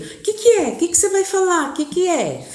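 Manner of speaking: put-on curious child. Children pester their father about his news, childlike. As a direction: play an impatient little kid. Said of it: "Sabe coisa de criança".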